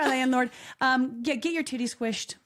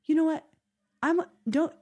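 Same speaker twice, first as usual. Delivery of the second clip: lightly voiced dad; 8 semitones higher than usual. Imitating dad's heartfelt speech.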